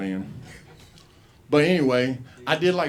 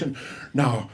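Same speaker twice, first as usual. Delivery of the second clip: ordinary delivery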